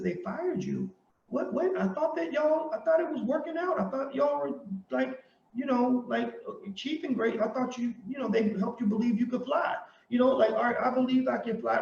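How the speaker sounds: mock surprise. Mock-shocked reaction to her firing.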